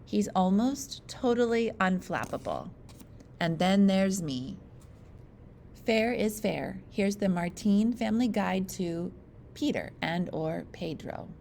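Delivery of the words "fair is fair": usual speaking voice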